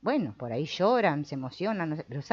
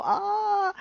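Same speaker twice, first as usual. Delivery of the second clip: a short, high, anguished ah; hammed-up cry; mock wail of distress; 4 dB louder than usual. Teacher mimics someone crying in a sea of emotions, high wail.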